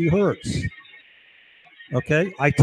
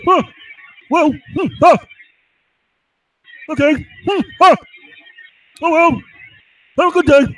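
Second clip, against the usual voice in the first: high, louder mocking voice of a stalling technician; mock evasive tech; 12 semitones higher, 5 dB louder than usual.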